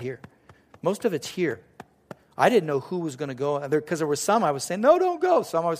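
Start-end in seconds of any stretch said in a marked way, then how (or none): 4.8-5.4 high, loud comic plea to stay; mock pleading. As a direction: beg them loudly in a raised pitch. Pleading with those he'd miss.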